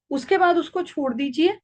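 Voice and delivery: usual speaking voice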